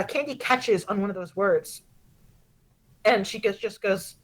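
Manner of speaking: normal talking voice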